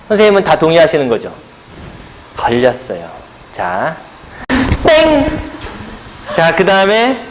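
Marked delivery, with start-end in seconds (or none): 4.8-5.4 short, high-pitched "ddaeng" for a wrong answer; mock buzzer "ding". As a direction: imitate a quiz bell in a high voice. Imitates wrong-answer buzzer sound. (높은 소리로 흉내 낸 땡 소리)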